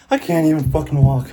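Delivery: winded and strained; exhausted. Real, not acted. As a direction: struggle to speak. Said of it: "I can barely walk".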